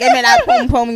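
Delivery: mock Jamaican accent. Mocking Jamaican talk.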